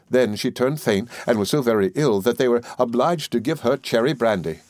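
ordinary delivery, nothing marked

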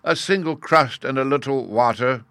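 pleading. A narrator's reading. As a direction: implore them for very little. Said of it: "he repeated".

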